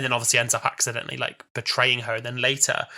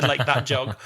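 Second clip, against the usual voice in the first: ordinary talk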